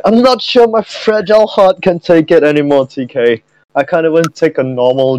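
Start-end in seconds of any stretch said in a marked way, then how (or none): 0.0-2.9 high whiny fragile voice; mock fragile whine. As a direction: whine like a hurt princess.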